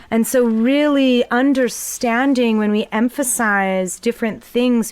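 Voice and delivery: usual speaking voice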